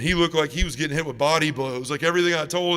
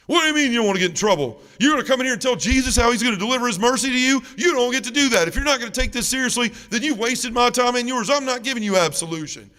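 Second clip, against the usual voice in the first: higher, louder, fast furious scolding; priest losing it; 8 semitones higher, 5 dB louder than usual. Retold or imitated. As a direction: tear into the penitent like an outraged priest. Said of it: "he lost it".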